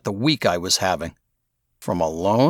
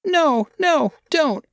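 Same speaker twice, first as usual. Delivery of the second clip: put-on high anxious young boy pleading; 3 dB louder, slower than usual. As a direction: beg like a scared kid. Boy begging not to tell.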